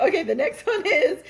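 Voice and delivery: ordinary talk, nothing marked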